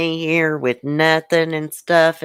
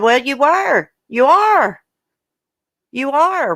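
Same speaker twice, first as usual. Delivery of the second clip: replaying her own retort to him, much higher and louder; retold angry retort; 11 semitones higher, 4 dB louder than usual. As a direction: re-enact the comeback, pitch climbing, voice up. Retold quarrel, telling ex off.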